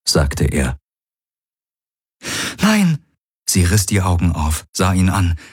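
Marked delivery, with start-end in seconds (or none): 2.6-3.0 very high, falling cry of no; alarmed protest. Acted. Woman refusing partner's vow to follow her, alarmed. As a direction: cut in, pitch leaping up. She cuts off his vow.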